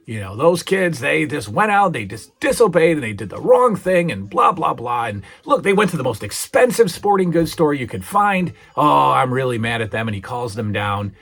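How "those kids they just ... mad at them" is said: mock furious father, raised and louder; angry dad rant. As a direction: huff like a fed-up dad. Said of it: "and he's furious".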